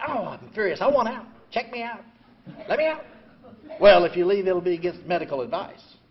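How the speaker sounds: usual speaking voice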